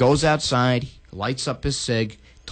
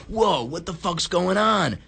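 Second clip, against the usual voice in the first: mock startled whoa; 5 semitones higher than usual. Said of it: "we're like".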